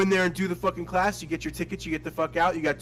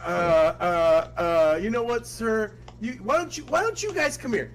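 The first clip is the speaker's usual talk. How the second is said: halting, climbing higher into polite unease; mock flustered; 5 semitones higher than usual. Seller steering troublemakers to another room, mock flustered. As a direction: stall nervously, then coax in a high voice.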